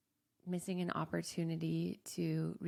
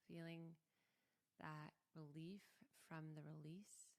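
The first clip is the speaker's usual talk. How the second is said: guided meditation; 8 dB quieter than usual. Acted. Guiding listeners to breathe with hands on heart, softly. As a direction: ease them into stillness, barely above a hush.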